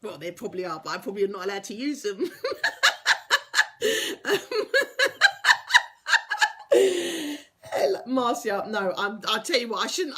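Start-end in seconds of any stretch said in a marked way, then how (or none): 2.3-4.2 high breathy giggles; helpless high laughter; giggling fit. Real, not acted. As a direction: crack up laughing. Cracks up at own joke.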